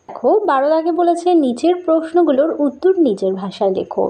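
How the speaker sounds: normal talking voice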